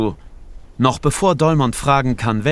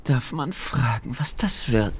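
sly witch whisper; 5 dB quieter than usual. A narrator's reading. Irritated witch at the door.

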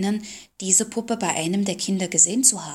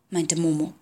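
plain reading voice

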